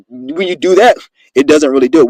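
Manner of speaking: usual speaking voice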